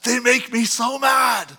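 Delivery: slow, frustrated complaining grumble; a hammed-up mad complaint to his wife; mock angry. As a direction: vent it like a fed-up dad. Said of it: "I would say to Holly".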